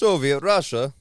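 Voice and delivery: loud mock Russian accent. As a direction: proclaim it like a Soviet. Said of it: "Can you do, like, a Russian accent?".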